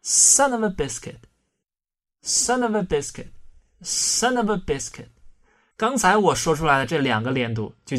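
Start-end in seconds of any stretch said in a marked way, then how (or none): 0.0-5.1 very slow, linked "son of a biscuit"; slow repeat-after-me demo; slow model reading. Shown as a demonstration. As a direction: draw out each linked sound for students to copy. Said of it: "来，大家可以跟我读一下".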